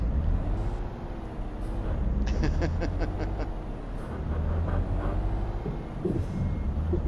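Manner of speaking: long helpless fit of laughter; laughing hard at the chat's teasing. Real, not acted. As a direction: break up laughing for a while.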